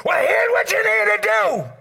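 high, loud barked orders; mock doctor yelling. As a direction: yell the orders like a rude doctor. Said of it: "he started yelling at you".

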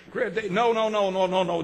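ordinary delivery

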